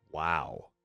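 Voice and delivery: ordinary delivery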